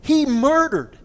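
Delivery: high, loud indignant cry; quoting an indignant newscaster; indignant outburst. Retold or imitated. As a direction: let the outrage burst out of you. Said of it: "she goes".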